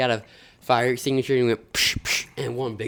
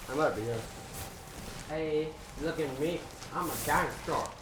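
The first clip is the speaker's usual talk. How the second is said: muffled inside a costume, far from mic; 4 semitones higher, 8 dB quieter than usual. Real, not acted. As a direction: talk from inside a big costume. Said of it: "You don't even have a mic".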